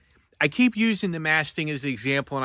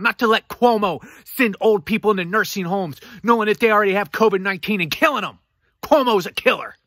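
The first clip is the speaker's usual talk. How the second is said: rising, louder angry rant with a higher voice; getting angry; 5 semitones higher, 6 dB louder than usual. Real, not acted. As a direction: let real anger boil over, faster at the end. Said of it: "I know I'm getting angry".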